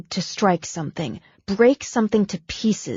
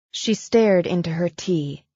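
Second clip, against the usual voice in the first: usual speaking voice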